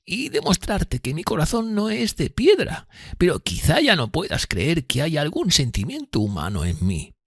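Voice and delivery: high-pitched character voice, timid and earnest; fearful Miss Havisham, earnest. Acted. Miss Havisham confiding in Pip, high fearful voice. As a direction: voice her high and thin above the narration.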